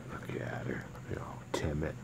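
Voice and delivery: soft whispered aside; hush, gently noting she's shy; whispering. Real, not acted. Soft aside about shy dog.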